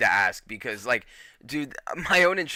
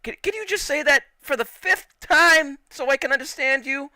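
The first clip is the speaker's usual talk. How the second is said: higher, louder pleading, fed up; exasperated; 11 semitones higher, 4 dB louder than usual. Retold or imitated. Asking the operator to repeat again, high exasperated plea. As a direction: beg her to repeat with mock exasperation. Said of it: "I'm like".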